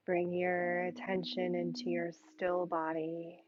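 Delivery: ordinary delivery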